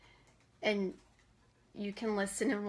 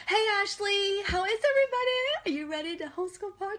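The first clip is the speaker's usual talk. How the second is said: excited greeting; 9 semitones higher, faster than usual. Real, not acted. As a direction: call out happily to a friend. Greets arriving viewers.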